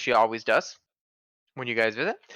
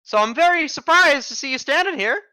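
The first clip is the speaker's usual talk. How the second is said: put-on high, louder old woman voice; a startled granny shopkeeper; old lady voice; 8 semitones higher, 4 dB louder than usual. Shopkeeper lady keeps talking.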